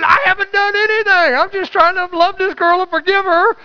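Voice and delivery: high, pleading disbelief; anxious inner voice. Retold or imitated. Inner thought while being shackled.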